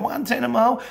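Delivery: high, loud disbelieving squeak; mock outrage at the price; incredulous echo. Another courier scoffs at a rate: high, loud disbelief. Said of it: "Someone's going".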